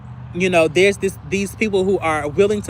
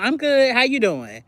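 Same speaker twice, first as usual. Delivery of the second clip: fake fine; 5 semitones higher than usual. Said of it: "A facade".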